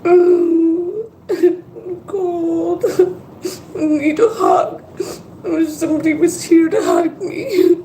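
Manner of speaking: tearful, high and breaking; sobbing through the words; crying. Real, not acted. Crying alone, wishes for a hug.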